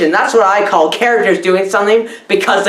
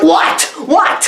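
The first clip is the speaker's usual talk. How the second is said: mock disbelief; 7 semitones higher than usual. Mock disbelief, woman rises.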